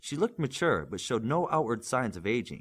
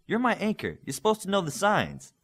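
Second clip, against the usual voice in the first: higher, exasperated complaint; frustrated; 11 semitones higher, 5 dB louder than usual. A narrator's reading. Olympian frustrated that his anchor can't read signs. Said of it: "Olympian said in frustration".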